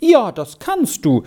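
high, emphatic yes; very decided. A narrator's reading. Girl grants boy his wish, very decided. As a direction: assure firmly, pitched high and falling. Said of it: "entgegnete die neue Bekannte sehr bestimmt".

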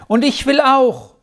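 high-pitched chiming in; eager child's call. A narrator's reading. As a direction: pipe up so as not to be left out. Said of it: "die Rufe der Zwillinge".

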